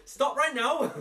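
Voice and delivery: high-pitched command in a put-on accent; jokey, high character accent; Sue's accent. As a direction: order it in a badly faked character accent. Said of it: "in the accent of Sue".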